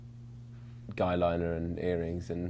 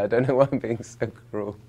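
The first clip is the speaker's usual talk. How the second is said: usual speaking voice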